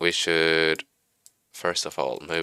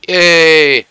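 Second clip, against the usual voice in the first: sharp gasp, then a high delighted yay; gasp, then cheer loudly; excited gasp and cheer; 10 semitones higher, 10 dB louder than usual. Real, not acted. Engines finally face forwards.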